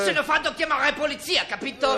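put-on shouting in a German accent, losing patience; angry German shout. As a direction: throw him out, furious. Threatens to call police.

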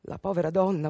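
usual speaking voice

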